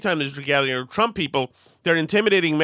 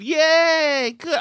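mock cheer; 11 semitones higher, 5 dB louder than usual.

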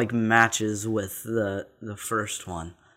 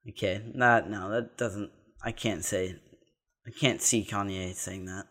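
ordinary talk, nothing marked

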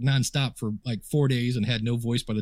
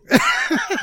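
burst of laughter; 6 dB louder than usual. Real, not acted. Punchline about job offer.

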